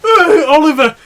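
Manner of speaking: high, loud, mock-scandalised call of the name; group's disgusted cry. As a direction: blame him like a whole class at once. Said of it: "And all the people going".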